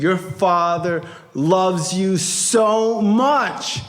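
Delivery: slow, drawn-out insistence; emphatic reassurance. Retold or imitated. Friend consoles a crying young man, emphatic reassurance. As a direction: stretch out every word to convince him. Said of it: "I called the Mad Russian".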